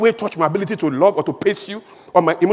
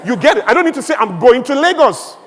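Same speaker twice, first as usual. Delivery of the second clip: usual speaking voice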